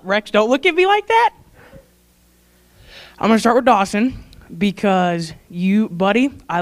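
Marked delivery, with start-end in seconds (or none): 0.0-1.3 higher, drawn-up rising protest; choked-up mock plea. Real, not acted. Speaker telling Rex not to set her off, pleading protest. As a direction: plead upward, fending off tears. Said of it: "definitely gonna get choked up".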